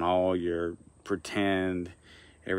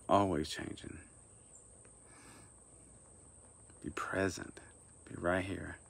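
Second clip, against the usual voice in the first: soft guided meditation with long pauses; quiet, unhurried meditation guidance; 6 dB quieter than usual. Acted. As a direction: lead them gently, leaving long silences between phrases.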